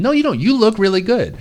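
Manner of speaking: high, bright encouragement; reassuring. Retold or imitated. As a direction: reassure him, lifted high. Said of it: "she was saying".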